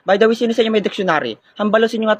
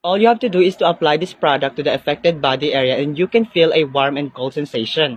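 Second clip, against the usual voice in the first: lower, measured product-plug voice; ad read; 4 semitones lower than usual. Acted. Friend plugs massage oil after the war game, low commercial read.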